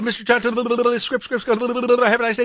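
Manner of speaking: high, loud, laughing gabble; laughing rush through script. Retold or imitated. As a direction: race through the script while cracking up.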